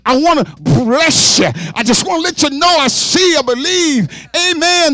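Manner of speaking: lightly voiced loving God, preacher's fervor; God speaking. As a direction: speak as God comforting his people. Voicing God to the congregation.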